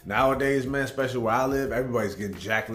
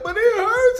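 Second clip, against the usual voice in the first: put-on whiny kid; 9 dB louder than usual. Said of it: "And I'm just like".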